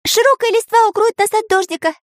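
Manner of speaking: high, fast cartoon voice; quick thinking. A narrator's reading. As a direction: chirp it out quickly. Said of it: "быстро сообразил Карандаш".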